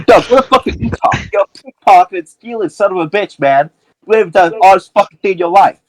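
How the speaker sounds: high-pitched, heated tirade; angry accusation. Acted. Julie turns on Doug, accusing him.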